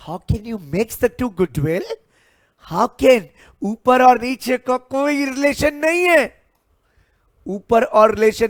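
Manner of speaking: high, loud, fast objection; worked-up teacher, pitch shooting up; exasperated protest. Real, not acted. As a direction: protest loudly at the class's mix-up. Teacher protesting at students' mistake.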